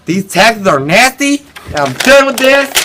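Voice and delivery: high, loud exasperated complaint; sigh, then explode in indignation; angry outburst. Acted. Colonist reacting to the British tax letter, angry outburst.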